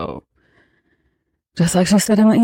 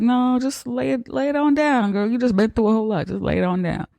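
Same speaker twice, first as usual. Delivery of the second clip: higher, fast, coaxing brush-off; brushing her off; 8 semitones higher than usual. Retold or imitated. Boyfriend keeping her home from the party, fast and coaxing. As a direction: hurry her back to bed sweetly. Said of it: "And he's like".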